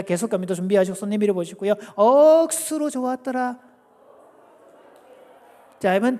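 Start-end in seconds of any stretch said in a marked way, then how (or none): 2.0-3.6 drawn-out, high Gyeongsang chant; Gyeongsang dialect. Shown as a demonstration. Preacher demonstrates Gyeongsang version to congregation, high and drawn out. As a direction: stretch the first word in Gyeongsang dialect. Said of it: "경상도 버전입니다".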